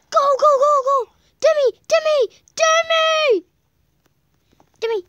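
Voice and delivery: high, loud urgent yelling; frantic shouting. Real, not acted. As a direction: scream at them to hurry. Urging character on.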